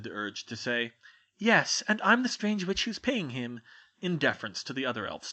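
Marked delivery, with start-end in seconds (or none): 1.4-3.6 put-on lightly higher girl's voice; a hint of brisk schoolgirl; young witch. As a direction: Hermione inviting the elf, lightly voiced girl. Hermione's unsaid retort.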